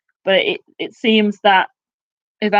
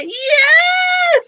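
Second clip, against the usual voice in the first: much higher, louder gleeful yell; triumphant shout; 5 dB louder than usual. Retold or imitated. Yelling at the telly as Umbridge is dragged off, triumphant shout. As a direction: cheer at the telly as the villain gets hers. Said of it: "And I'm like".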